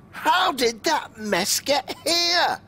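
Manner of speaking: high, slow, loud angry shout; furious. A narrator's reading. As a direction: bellow it out in outrage, drawn out. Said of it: "Badger was furious".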